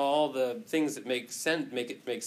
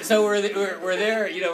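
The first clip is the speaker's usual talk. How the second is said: ordinary talk, nothing marked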